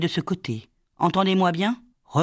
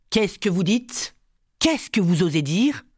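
shocked demand, rising at the end; mildly raised, affronted questioning; outraged cry; 3 semitones higher than usual. Acted. Madeleine blanches at Violette's threat, outraged questioning. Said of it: "Ne criez pas comme cela".

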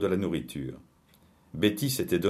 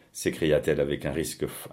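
plain reading voice

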